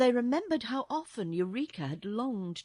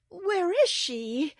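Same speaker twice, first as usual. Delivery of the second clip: high, loud, slow question; upset little girl; 10 semitones higher, 8 dB louder, slower than usual. A narrator's reading. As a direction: ask in a high girl's voice. Upset girl asks after her kitten.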